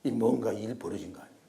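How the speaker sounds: normal talking voice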